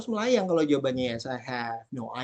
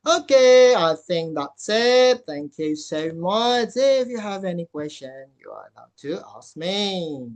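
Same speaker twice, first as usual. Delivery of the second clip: loud sign-off; 6 dB louder, slower than usual. Real, not acted. Teacher closes talk and invites questions, loud drawn-out sign-off. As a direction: stretch each phrase out as you wrap up.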